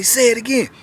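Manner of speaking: egging him on, hammed-up; retold dare. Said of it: "So he say".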